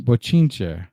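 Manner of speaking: ordinary delivery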